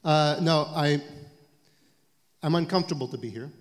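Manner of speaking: ordinary talk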